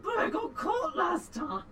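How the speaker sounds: high, slow, shouted goblin whine; whiny goblin. Acted. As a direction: whine like a scared little goblin.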